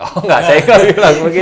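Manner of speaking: laughing loudly and high; laughing denial. Real, not acted. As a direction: deny it through a big laugh. Joking denial after mock claim. (menyangkal sambil tertawa keras)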